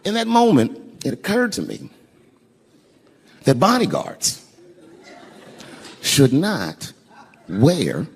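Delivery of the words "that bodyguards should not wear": slow pointed punchline. Pretend. Telling the crowd slowly what bodyguards should not wear. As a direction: stretch it out slowly, word by word.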